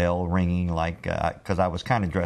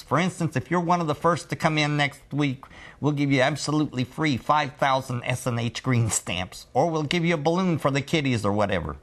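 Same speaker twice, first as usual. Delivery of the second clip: higher, lisping car-dealer pitch; 6 semitones higher than usual. Retold or imitated. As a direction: sell it like a lisping car salesman. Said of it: "he sort of sounded like this".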